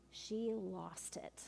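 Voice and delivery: "She lost it" whispered slowly; dramatic whisper. Retold or imitated. Youth leader telling students the woman snapped, in a slow whisper. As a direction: drop to a slow hushed whisper.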